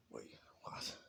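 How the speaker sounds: whispered disbelief; a hushed, startled wait, what; stunned whisper. Acted. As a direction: breathe it out in shock. Deku shocked at growing huge.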